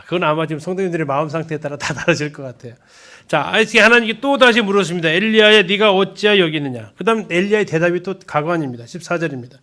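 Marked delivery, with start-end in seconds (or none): 5.1-6.9 혼내는 하나님의 목소리; scolding God. Acted. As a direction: call him out sharply like a stern father. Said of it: "삼 번 혼내는 목소리".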